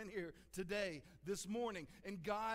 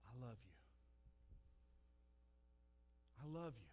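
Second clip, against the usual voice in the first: soft whisper; 8 semitones lower, 13 dB quieter, faster than usual. Acted. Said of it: "God's voice just whispers".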